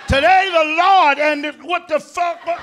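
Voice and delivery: a preacher interrupted mid-sermon. Retold or imitated. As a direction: boom like a pulpit, then snap into shock. Preacher voiced, then empty plate.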